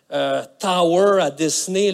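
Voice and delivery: ordinary delivery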